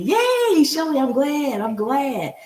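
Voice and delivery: a high, loud little cheer; high, loud delighted yay; excited yay. Real, not acted. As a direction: cheer the viewer's name in. Greeting a viewer in chat.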